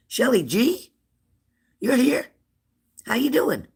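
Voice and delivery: light up as a friend arrives; excited greeting. Real, not acted.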